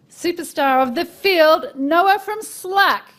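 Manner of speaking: loud, slow, high emcee build-up; announcing the guests. Real, not acted. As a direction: hype each name for applause. Host introduces panel to applause.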